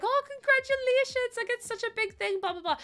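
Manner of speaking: high, sing-song congratulations; imitating gushing well-wishers. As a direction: gush like a delighted well-wisher. Acting out excited well-wishers.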